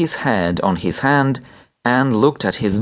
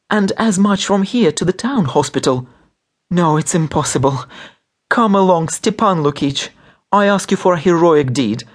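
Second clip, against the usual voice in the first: high-pitched, urgent argument; desperate pleading; 8 semitones higher than usual. A narrator's reading. Wife begging the doctor for a heroic deed, desperate pleading. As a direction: beg him with mounting urgency.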